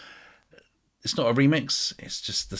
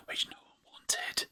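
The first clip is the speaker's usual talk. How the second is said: soft snide whisper under the breath; whispered sarcastic dig; whispered aside; 11 dB quieter, slower than usual. Real, not acted. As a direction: drop to a conspiratorial whisper. Sarcastic aside about unwanted box set.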